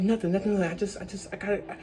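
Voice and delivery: quiet, trailing mumble; brushing off questions. Retold or imitated. Shaken friend brushing off the others' questions, mumbled. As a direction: imitate a shaken friend, voice dropping away.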